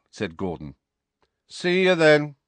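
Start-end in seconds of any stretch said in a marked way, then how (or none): 1.5-2.4 high, loud parting call; raised-voice farewell; called goodbye. A narrator's reading. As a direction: call it across to departing mates. Bully calling goodbye to gang.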